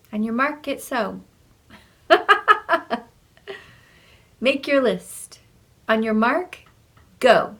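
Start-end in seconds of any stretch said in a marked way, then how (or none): 2.1-3.0 short high laugh; burst of laughter. Real, not acted. Laughs at her own slip. 4.4-7.5 loud start command. Shown as a demonstration.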